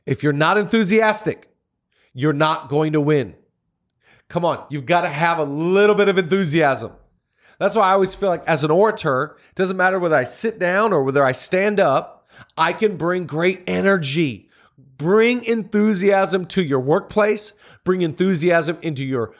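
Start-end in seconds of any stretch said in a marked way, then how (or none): none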